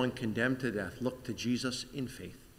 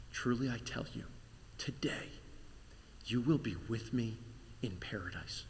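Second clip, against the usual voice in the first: whisper; 7 dB quieter than usual. Acted. Dying God speaks to criminal.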